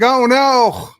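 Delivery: high, loud "oh no"; horrified cry as plane burns; dismayed cry. Retold or imitated. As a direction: gasp it out in horror. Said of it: "I was like".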